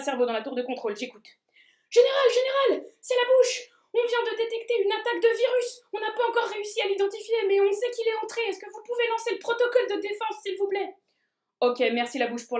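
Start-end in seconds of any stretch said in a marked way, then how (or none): 1.9-2.9 high urgent whisper. Acted. Mouth calling urgently for general. 3.0-10.9 high, eager cartoon messenger. Narrator voices the mouth.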